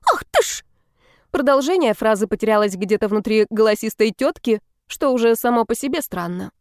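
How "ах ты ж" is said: shrill neighbour. A narrator's reading. Neighbour outraged, choked off.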